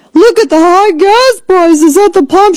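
high, mocking imitation of a congresswoman; mocking impression. Mocking the congresswoman's video in a high voice. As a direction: pitch it way up to mock her. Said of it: "She did this video".

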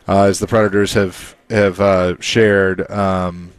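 ordinary talk

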